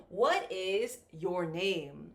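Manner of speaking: slow model question, settling low on "name"; falling question intonation. Shown as a demonstration. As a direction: start on "What" and step down to "name". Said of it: "The intonation will go down".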